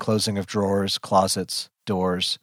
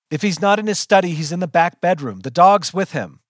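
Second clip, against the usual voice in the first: hurried, raised and loud; impatient yelling; 7 semitones higher, 5 dB louder than usual. A narrator's reading. Pinned narrator yells at the jerks holding them, hurried. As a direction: yell directions, out of patience. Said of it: "I am really yelling now".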